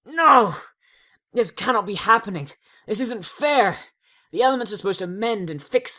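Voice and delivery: high, loud anguished protest; despairing cry. Acted. Twilight crying out that the magic is rejecting her. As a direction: shout it out against the unfairness.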